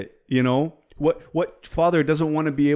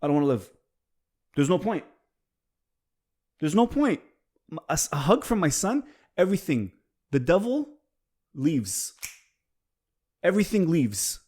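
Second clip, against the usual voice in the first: touched; 5 semitones higher than usual. Real, not acted. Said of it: "now I'm getting emotional".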